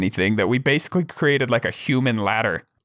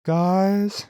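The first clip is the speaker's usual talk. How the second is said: imitating a kid's higher-pitched call to his tentmates; lightly put-on higher kid voice; retold timid call; 6 semitones higher than usual. Scared kid calls out to tentmates at night.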